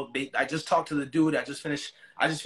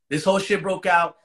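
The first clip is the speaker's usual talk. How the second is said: said again, louder, for emphasis; louder repeat; 4 semitones higher, 8 dB louder than usual. Real, not acted. Telling a friend how the fight broke out, louder. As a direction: hit the whole line harder on the repeat.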